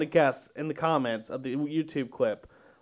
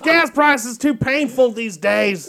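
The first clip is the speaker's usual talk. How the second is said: dude at the pump; 6 semitones higher, 6 dB louder than usual. Retold or imitated. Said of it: "some dude next to him".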